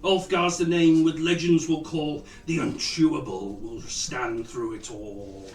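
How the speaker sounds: grand rhythmic bard. Acted. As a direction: proclaim it like a bard. Bard ballad for Ulfgar.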